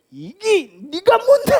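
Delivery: slow, high and pointed; mock angry challenge. Preacher voicing a man provoking a fatal fight, slow mock anger. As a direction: spit it out like picking a fight. Acted quarrel before a killing.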